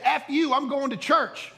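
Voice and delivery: high, loud mock yell; imitating an indignant churchgoer; defiant retort. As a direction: hurl it back across the street. Angry churchgoer yelling at neighbour.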